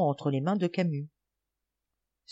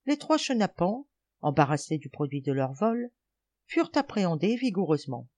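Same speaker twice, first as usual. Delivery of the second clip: ordinary delivery, nothing marked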